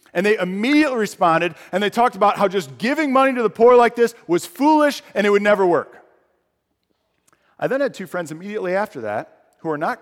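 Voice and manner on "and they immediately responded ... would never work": louder, higher sermon build; heated preaching; rising preaching. Real, not acted. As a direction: build the indignation.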